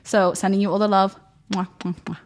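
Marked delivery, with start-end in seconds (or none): 1.5-2.2 blown kisses. Real, not acted. Blowing kisses at sign-off.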